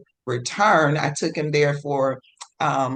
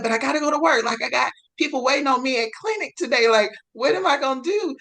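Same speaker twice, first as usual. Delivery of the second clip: higher, rushed, flustered; higher quick excited fluster; flustered excitement; 7 semitones higher, faster than usual. Retold or imitated. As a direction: tumble over the words, thrilled and panicked.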